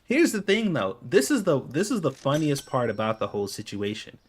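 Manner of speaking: usual speaking voice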